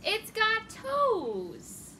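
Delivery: slow, delighted exclamation; exclaiming. Acted. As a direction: drag it out in amazement.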